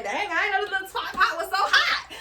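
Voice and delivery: high laughing mock of the ex. Vlogger mocking what her ex thought, high and laughing. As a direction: imitate him half-laughing. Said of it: "it's like".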